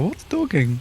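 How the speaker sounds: put-on playful Norwegian accent question. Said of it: "in a Norwegian accent".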